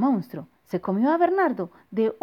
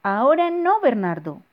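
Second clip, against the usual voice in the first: dismissive shout; 5 semitones higher, 7 dB louder than usual. Acted. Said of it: "dijo la mamá de Bernardo".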